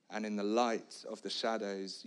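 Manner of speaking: ordinary talk, nothing marked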